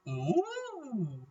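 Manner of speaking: delighted. Real, not acted. Likes new item.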